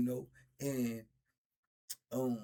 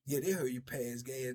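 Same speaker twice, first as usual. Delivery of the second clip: ordinary delivery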